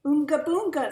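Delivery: put-on high, loud baby talk; mother voice. A narrator's reading. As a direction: coo at the baby like mom. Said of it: "said her mother".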